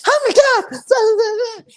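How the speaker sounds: loud, very high cartoon squeal; stretched-out Mickey Mouse shriek; Dickie impression. Imitating grandpa's weird friend exclaiming, squealing Mickey Mouse voice. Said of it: "He sounds like Mickey Mouse".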